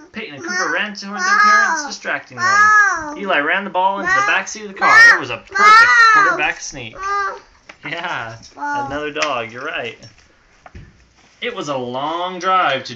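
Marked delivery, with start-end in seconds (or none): 0.1-7.0 excited. A narrator's reading. Exciting trick play read aloud.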